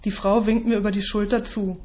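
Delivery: ordinary delivery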